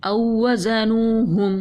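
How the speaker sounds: slow, chanted Quranic reading; slow recitation. Shown as a demonstration. Teacher demonstrating tajweed recitation to pupils, slow chant. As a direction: draw every sound out like in tajweed class. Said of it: "इज़ ज़हब".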